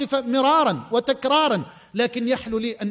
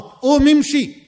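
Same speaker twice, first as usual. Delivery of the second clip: slow, louder commanding call; 2 semitones higher, 7 dB louder, slower than usual. Retold or imitated. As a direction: boom the command slowly. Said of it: "ثلاث مرات".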